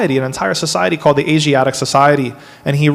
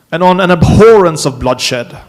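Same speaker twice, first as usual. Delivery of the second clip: mock British accent, higher and louder; 4 semitones higher, 4 dB louder than usual. Lecturer quoting Hastings to students in a mock British accent. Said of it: "can I do my British accent here".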